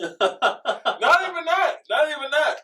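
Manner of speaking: high, loud laughing cry; laughing shout. Real, not acted. Boasting to his friend, high laughing shout. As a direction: crow it out through laughter.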